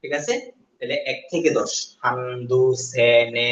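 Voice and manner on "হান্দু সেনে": হান্দু সেনে শাসক drawn out slowly, each word apart; slow drill. Shown as a demonstration. Teacher drilling Korean numbers slowly for students. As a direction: count slowly so students can follow. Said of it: "reciting drill".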